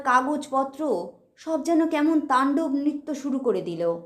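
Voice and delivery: ordinary delivery, nothing marked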